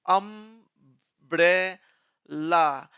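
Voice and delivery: अम्ब्रेला very slowly in three separate parts, am-bre-la; teacher sounding out "अम्ब्रेला" with long drawn syllables. Shown as a demonstration. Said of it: "छोटा करके pronounce करने के लिए".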